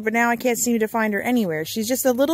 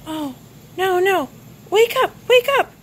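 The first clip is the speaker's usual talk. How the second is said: rising, loud desperate calls; frantic pleading; 7 semitones higher, 5 dB louder than usual. Acted. Player begs the bunny to wake, frantic. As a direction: beg her to wake up.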